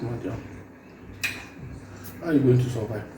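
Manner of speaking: normal talking voice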